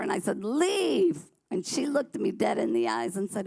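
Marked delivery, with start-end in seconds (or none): 0.4-1.3 sharp high yell of leave; cussing her out. Retold or imitated. Drug-sick daughter ordering mom out, yelling. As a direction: snap the word out at mom. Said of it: "I started cussing at her".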